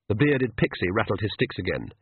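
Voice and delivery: plain reading voice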